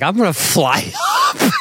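high-pitched mock mom voice; imitated anxious mom. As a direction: quote mom in a high voice, breaking up. Retold mom offering to fly up.

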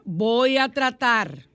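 mock hesitant Voy a tratar, stretched slowly; mock doubtful. Shown as a demonstration. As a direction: whine it out as if giving up already. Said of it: "otra palabra".

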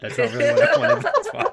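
high laughing giggle. Real, not acted. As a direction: laugh through the words. Laughing at own failed voice.

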